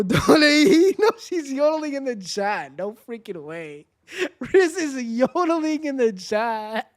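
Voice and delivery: high, breaking into laughter; laughing squeal. Real, not acted. Streamer hears Riz yodeling in chat, laughing high. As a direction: squeal through giggles at the yodel.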